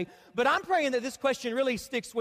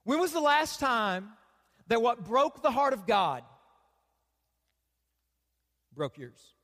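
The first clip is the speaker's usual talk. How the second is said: solemn preaching question; 2 semitones lower, slower than usual. Acted. Pastor puts the key question to the congregation, slow solemn preaching. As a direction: let each phrase land, then sink to almost nothing.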